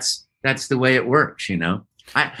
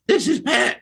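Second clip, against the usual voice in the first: very high, breaking attempt at speech; acted squeaky, failing voice; losing voice; 11 semitones higher than usual. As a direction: squeeze it out as if the voice is gone. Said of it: "And I started losing my voice".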